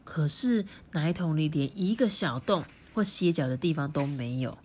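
ordinary delivery

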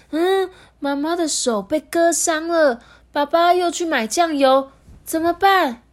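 put-on high, loud worried child voice; panicked child. A narrator's reading. Little Lemon finds mum's hand cut, panicked.

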